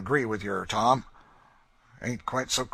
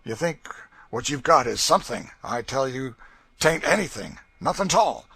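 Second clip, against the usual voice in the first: loud, higher, mocking menace; gloating threat; 5 semitones higher, 10 dB louder than usual. A narrator's reading. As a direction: relish the threat of burning him. Legree threatening torture.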